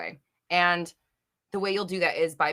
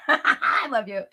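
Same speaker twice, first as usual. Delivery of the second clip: laughing; 9 semitones higher, 6 dB louder, much slower than usual. Real, not acted. Laughing affection to viewer.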